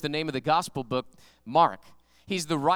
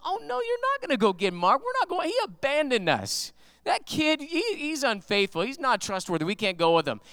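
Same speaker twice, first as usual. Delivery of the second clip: mock-indignant, pitched up in objection; indignant refusal; 7 semitones higher than usual. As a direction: act out an outraged argument. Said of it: "they had a fight".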